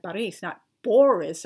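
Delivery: Paris said slowly and loud, then Boris as the wrong version; loud, slow correction of Paris against Boris; pronunciation contrast. Shown as a demonstration. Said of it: "is properly pronounced".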